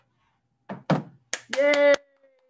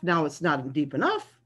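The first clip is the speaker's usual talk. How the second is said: ordinary delivery, nothing marked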